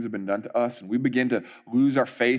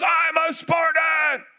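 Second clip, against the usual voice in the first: high, slow shouted chant; crowd yell; slower than usual. Retold or imitated. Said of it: "Everybody yells".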